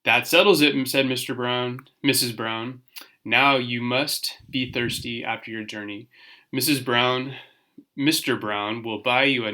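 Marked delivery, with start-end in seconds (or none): none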